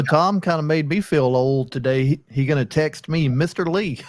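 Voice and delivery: normal talking voice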